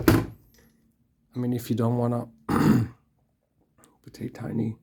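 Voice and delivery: hoarse, slow and throat-clearing. Real, not acted. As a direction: voice giving out mid-sentence. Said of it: "losing my voice".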